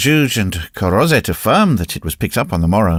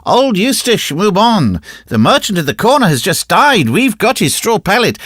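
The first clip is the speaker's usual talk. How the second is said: put-on shouting children; 10 semitones higher, 4 dB louder than usual. Children shouting their news.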